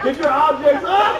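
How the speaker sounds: high, drawn-out call to the crowd. Acted. Host telling the audience to raise objects, calling out. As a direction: holler it across the room, stretched.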